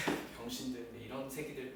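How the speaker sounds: sigh, then soft scornful muttering; weary contempt. Retold or imitated. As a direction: quietly despair at these idiots. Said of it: "이러셨어".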